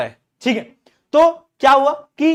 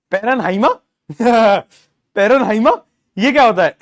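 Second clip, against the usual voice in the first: laughing mispronunciation; 6 dB louder than usual. Real, not acted. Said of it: "वाह यार, बोलते ही नहीं है।".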